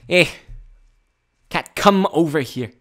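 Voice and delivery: loud Brooklyn cat call; Brooklyn accent. Acted. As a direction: summon a cat in hammed-up Brooklynese. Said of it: "if I had that accent".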